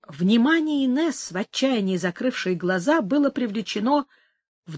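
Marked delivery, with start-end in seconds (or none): none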